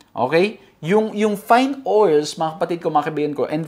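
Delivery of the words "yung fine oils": ordinary delivery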